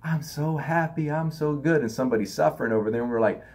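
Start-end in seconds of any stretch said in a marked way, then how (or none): 0.0-1.8 a mock sing-song, pretending to be happy and good; fake happiness. Mocking people who pretend to be happy, fake cheerful voice. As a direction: put on a sweetly fake cheerful tone. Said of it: "pretending that we're happy".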